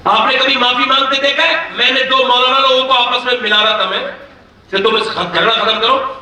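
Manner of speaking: normal talking voice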